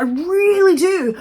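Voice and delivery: high, slow, laughing through it. Real, not acted. To viewers after the fart clip, laughing. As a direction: crack up mid-line.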